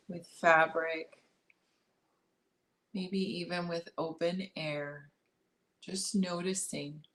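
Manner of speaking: calm meditation guide, long pauses between phrases; unhurried body-scan guidance, leaving space after each instruction; guided meditation. Acted. Guiding listeners to notice breath and body, guided meditation.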